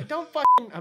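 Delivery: loud, high irritated snap; fed up with interruptions; annoyed. Real, not acted. Friends interrupting.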